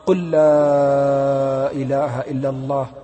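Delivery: slow, low, drawn-out urging; gentle plea. Retold or imitated. The sheikh urges the dying youth, slow. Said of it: "وأنا أقول".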